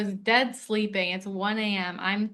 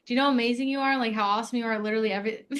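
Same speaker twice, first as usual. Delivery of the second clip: rapid disbelief; much faster than usual. Retold or imitated. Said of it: "I'm like".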